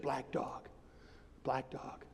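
low, soft repeated quote; hushed retold answer. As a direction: imitate a friend's cryptic reply, quietly. Retold friend repeats cryptic answer.

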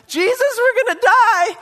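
high, loud panicked scream to Jesus; terrified disciples shouting over the storm; panicked cry. Retold or imitated. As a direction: cry out like sailors sure they'll drown.

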